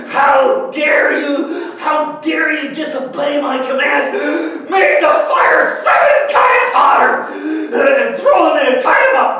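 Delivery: furious king shouting orders, words stretched; loud, slow roar of outrage, building to the end; royal temper tantrum. Acted. Enraged king to disobedient captives, furious shout. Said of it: "he is so mad".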